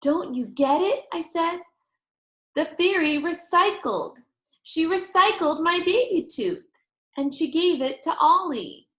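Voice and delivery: put-on high, loud eager child; gleeful explaining. A narrator's reading. Girl skipping round parents, excited. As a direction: spell it out, bursting.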